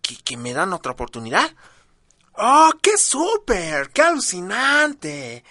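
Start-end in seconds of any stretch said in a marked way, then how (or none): 2.3-5.4 mock delight. Mock delight at second chance.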